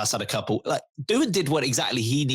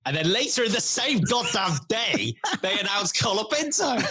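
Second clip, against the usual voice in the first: exasperated; 10 semitones higher than usual. Real, not acted. Building outrage at team news.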